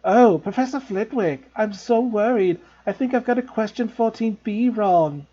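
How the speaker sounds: put-on high, slow simpering girl; boy mocking a friend in a high voice. A narrator's reading. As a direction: mimic a fussy swot. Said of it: "He put on a high voice".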